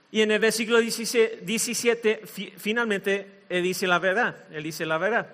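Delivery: ordinary talk, nothing marked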